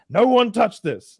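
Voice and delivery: high, slow warning; imitating a man's emphatic order; mock stern command. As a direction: lay down a rule for foreigners, hammed up. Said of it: "he's like".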